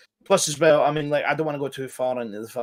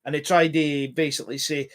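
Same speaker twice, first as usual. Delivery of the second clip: normal talking voice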